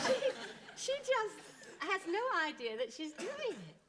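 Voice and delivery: talked through real laughter; high, giggling delivery; laughing. Real, not acted. Laughing while telling.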